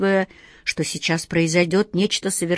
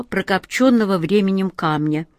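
plain reading voice

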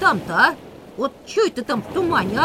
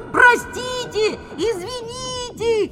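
nervous mock politeness; 8 semitones higher, slower than usual. Mock polite farewell, fleeing.

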